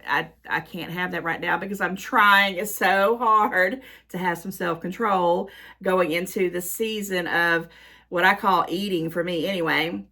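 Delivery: ordinary talk, nothing marked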